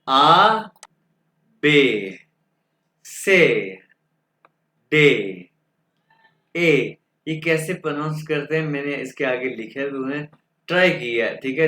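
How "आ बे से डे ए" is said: each letter called out singly, with long pauses; German alphabet demonstration. Shown as a demonstration. Teacher reads alphabet for students to follow, emphatic spaced-out letters. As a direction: model each letter name firmly, drawn out, one at a time. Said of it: "दोबारा pronounce करके बताऊंगा".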